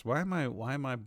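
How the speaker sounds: usual speaking voice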